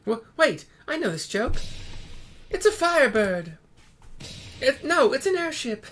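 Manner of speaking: put-on high, loud excited little girl; Tataru voice. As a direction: squeal out the punchline. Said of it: "says Tataru".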